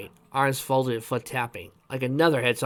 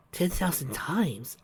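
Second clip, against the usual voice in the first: soft, slow, higher-pitched question; hushed, drawn-out disbelief; wide-eyed wonder; 5 semitones higher, 6 dB quieter, slower than usual. A narrator's reading. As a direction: breathe it out in amazement. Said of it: "wide-eyed".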